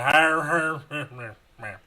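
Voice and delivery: loud mock drunken laugh, trailing off; drunk Irish laugh. Imitating a drunk Dublin pub guy, mock laughing. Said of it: "And he's like".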